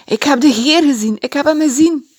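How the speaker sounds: high, loud joyful shout; shouting joyfully. Acted. Maria bursts in to tell the friends, shouting joyfully. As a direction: burst in and announce it. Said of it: "Ze stormde naar binnen en riep".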